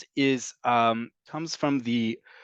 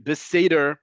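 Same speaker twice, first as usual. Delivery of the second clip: beseder in an American accent, "say-der"; emphatic American-accented beseder, louder; Americanized beseder with long "ay" and English r; 3 semitones higher, 5 dB louder than usual. Shown as a demonstration. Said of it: "own your American accent".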